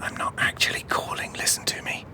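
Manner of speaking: secret urgent whisper. Real, not acted. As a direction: whisper the plan behind the fake call. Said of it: "What are you doing?".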